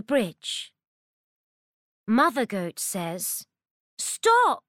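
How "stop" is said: high, loud shout to stop; frantic shout. Acted. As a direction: yell after the runaway kid. Mother Goat calls out.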